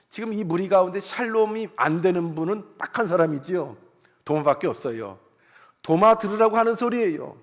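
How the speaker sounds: normal talking voice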